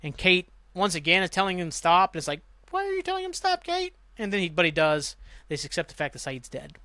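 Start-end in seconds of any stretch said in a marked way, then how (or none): 2.7-3.9 high squeaky disbelieving voice; incredulous mock. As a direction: sneer the question up high at Kate. Mocking Kate for stopping Jack.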